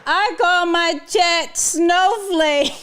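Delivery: high, stretched-out words tipping into laughter; incredulous, about to laugh. Real, not acted. Streamer repeating a viewer's chatbot nickname, incredulous and cracking up. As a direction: draw it out high as the laugh takes over.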